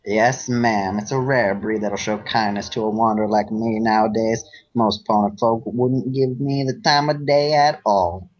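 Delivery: a folksy Southern cowpony sweet-talking a stranger; Braeburn southern accent. Acted. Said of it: "uh, southern accent".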